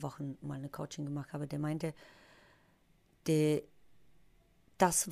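usual speaking voice

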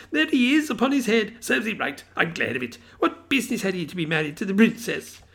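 high, eager, half laughing half crying; an old man giddy with childhood memories; excited old Scrooge. A narrator's reading. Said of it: "a most extraordinary voice between laughing and crying".

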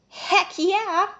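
high eager cheer; excited. Acted. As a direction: burst out with glee. Pixie eager to escape.

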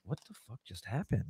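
very soft, low murmur to himself; stunned self-talk. Retold or imitated. Man retelling his shock alone in the bathroom, stunned low murmur. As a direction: retell a private thought under your breath.

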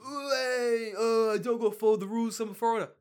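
high, loud brash foreigner voice; obnoxious foreigner. Retold or imitated. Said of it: "Just being like".

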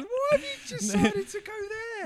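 very high, slow, giggling question; very high laughing mock query; laughing disbelief. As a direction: squeak it out through laughter at the absurd scene. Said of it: "everybody going".

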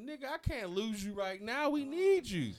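high, rising, insistent appeal; acted-out desperate urging; retold urgent plea. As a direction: plead with a friend, pitch climbing. Retells his plea to crying friend.

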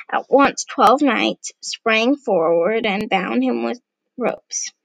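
plain reading voice

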